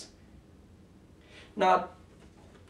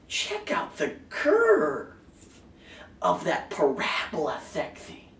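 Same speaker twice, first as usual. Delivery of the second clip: mock-sexy admiration; slower than usual. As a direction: stretch it out, playfully lustful. Mock-sexy admiring line in poem.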